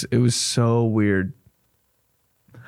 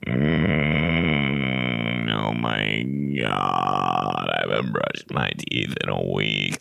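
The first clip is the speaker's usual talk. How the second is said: talking stomach; 13 semitones lower, 4 dB quieter, much slower than usual. Pretend. Stomach talks again.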